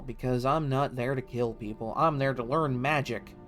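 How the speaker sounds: ordinary talk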